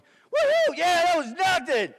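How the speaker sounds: high, very loud triumphant holler; exhilarated whoop. Retold or imitated. Driver cheering after surviving a bomb hit, exhilarated whoop.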